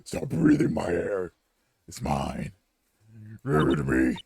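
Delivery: slow, forceful demand dropping very low; crazed menace. Acted. Crazed trapped man demanding the air, deep menace. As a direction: sink into a deep, threatening voice.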